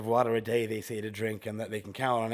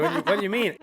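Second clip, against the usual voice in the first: laughing; 6 semitones higher, 4 dB louder, much faster than usual. Retold or imitated. Retold confused reply.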